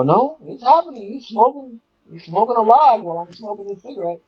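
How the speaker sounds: high-pitched retold disbelief; incredulous. Storyteller replaying the argument in his head, incredulous. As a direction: argue it out in a high, amazed voice.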